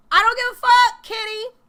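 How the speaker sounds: fired-up defiant yell; defiant shout. Real, not acted. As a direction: shout it at the doubters. Hyped defiance about the price.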